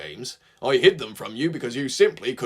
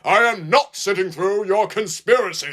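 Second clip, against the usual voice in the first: firm; 7 semitones higher, 3 dB louder than usual. A narrator's reading. Gordon refusing firmly.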